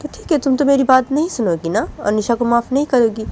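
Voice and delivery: angry scolding mother. Acted. Mother scolds crying daughter.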